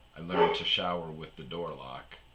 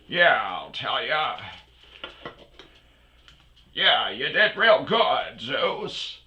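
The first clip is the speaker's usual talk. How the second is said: put-on high, slow drawling old movie star; an old Hollywood twang, slowed down; old movie actor voice; 6 semitones higher, 6 dB louder, slower than usual. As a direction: do a slow folksy Gary Cooper type. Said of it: "they all kinda talk like this".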